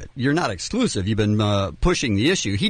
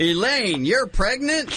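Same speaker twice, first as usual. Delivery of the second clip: high, surprised radio host; Ryan Seacrest impression; 9 semitones higher, slower than usual. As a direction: act shocked like a DJ. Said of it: "That's a pretty good Ryan".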